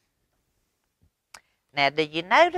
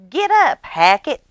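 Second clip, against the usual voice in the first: put-on high, loud, eager little girl; high bright child calling her brother; Ivy's bossy little-girl voice; 10 semitones higher, 8 dB louder than usual. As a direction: wake him up like an excited big sister. Ivy's voice in the story.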